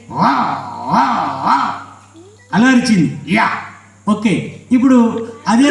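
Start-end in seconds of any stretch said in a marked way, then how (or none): 0.1-1.7 short, separate dog barks; dog bark imitation. Puppet showing how the street dog barked, barking. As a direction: bark like a dog, one yelp at a time. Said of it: "ఎలా అరిచింది".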